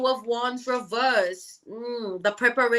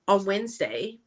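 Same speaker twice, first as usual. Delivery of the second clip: ordinary talk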